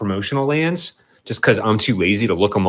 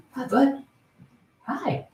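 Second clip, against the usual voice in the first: cooing pet voice; 4 dB quieter, slower than usual. Real, not acted. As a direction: coo sweetly at the little cat, pitched way up. Cooing at the cat.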